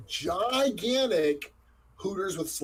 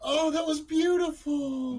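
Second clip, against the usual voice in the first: delighted praise; 10 semitones higher, slower than usual. Real, not acted. Gushing reaction to the accent.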